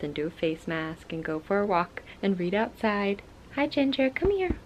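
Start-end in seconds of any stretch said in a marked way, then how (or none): 3.5-4.6 high sing-song call to the pet. Real, not acted. Calling her pet Ginger.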